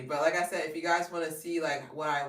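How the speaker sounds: normal talking voice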